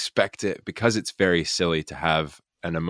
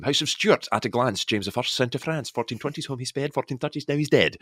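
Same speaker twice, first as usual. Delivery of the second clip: Scottish accent on beast mode; 6 semitones higher than usual. Shown as a demonstration. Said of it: "Scottish accent on beast mode".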